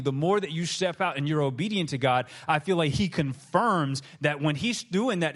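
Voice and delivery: ordinary talk, nothing marked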